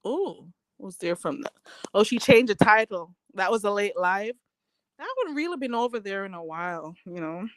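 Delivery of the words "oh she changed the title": ordinary talk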